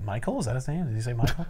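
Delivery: puzzled murmur. Retold or imitated. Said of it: "everyone's like".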